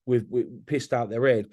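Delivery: ordinary talk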